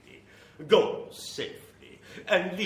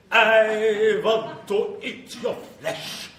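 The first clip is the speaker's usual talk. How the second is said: menacing vampire; 8 semitones higher, 7 dB louder, much slower than usual. Acted.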